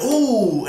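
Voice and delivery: high, drawn-out crowd "oooh"; imitated crowd "ooh". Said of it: "I hear everyone saying".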